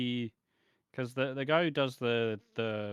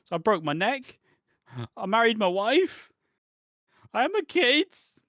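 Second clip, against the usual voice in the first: much higher, much louder, slow escalating cry; breathless mock wail; 11 semitones higher, 9 dB louder than usual. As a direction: crank the tragedy up to a frantic howl. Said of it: "he doesn't stop to catch a fucking breath".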